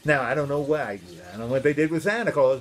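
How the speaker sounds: ordinary delivery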